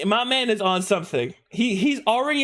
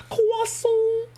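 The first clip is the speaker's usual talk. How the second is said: very high, squeaky Japanglish mimicry; Japanglish accent. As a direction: echo it in high-pitched Japanese-accented English. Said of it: "I love the Japanglish accent".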